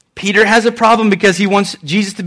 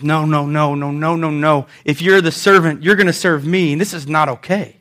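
quiet refusal; 6 semitones lower, 4 dB quieter than usual. Acted. Peter quietly refusing Jesus.

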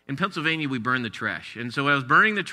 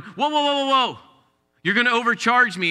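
much higher, alarmed pretend customer; mock shopper; 6 semitones higher than usual. Pretend deli shopper protesting the scale, mock alarm. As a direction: ham up a panicked customer.

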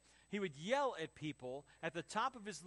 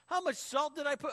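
loud, high cry of dismay; appalled exclamation; 8 semitones higher, 8 dB louder than usual. Retold or imitated. Teen tasting his own omelet, appalled loud cry. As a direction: exclaim in horror at the taste.

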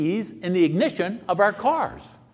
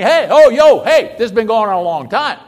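brash complaint; 4 semitones higher, 6 dB louder than usual. Retold or imitated. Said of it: "I can see going to God and saying".

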